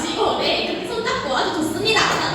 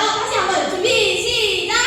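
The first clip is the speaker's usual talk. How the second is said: normal talking voice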